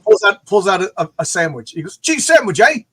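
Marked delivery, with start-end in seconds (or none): 2.0-2.8 high, chirpy British offer. Retold or imitated. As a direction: offer it casually in a mock British voice. Lemmy offers sandwich, accent imitation.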